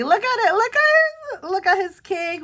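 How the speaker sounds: proud parent showing off a birthday cake, gushing; excited gushing. Real, not acted. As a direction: squeal with delight at the picture. Showing son's birthday cake photo.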